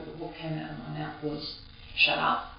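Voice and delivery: normal talking voice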